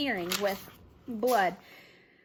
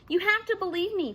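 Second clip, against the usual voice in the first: higher, pleading insistence; boy begging his friends to listen; insisting; 8 semitones higher, 3 dB louder than usual. A narrator's reading. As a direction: make them believe you. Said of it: "Chet insisted".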